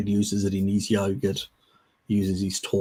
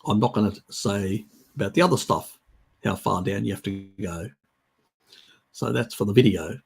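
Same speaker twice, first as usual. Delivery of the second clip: ordinary talk, nothing marked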